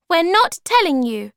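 high, loud stubborn refusal; squeaky defiant Bob; defiant small creature. Acted.

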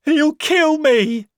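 very high, loud, slow panicked wail; terrified cry. Acted. Marvel crying out to the policeman in terror. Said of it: "cried Marvel".

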